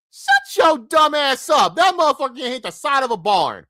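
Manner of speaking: a shrieking high rant at foolish fans; exasperated shout. Real, not acted. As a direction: yell down the fans in disbelief. Mocking dumb Canes fans.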